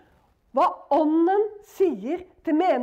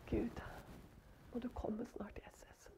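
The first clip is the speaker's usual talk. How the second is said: hushed, lower whisper; whispered prayer; 7 semitones lower, 23 dB quieter than usual. Real, not acted. Believer whispering a prayer to God.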